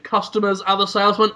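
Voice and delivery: usual speaking voice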